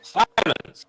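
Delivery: high-pitched cry for silence; commanding character. Acted.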